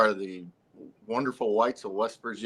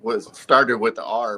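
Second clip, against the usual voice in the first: ordinary delivery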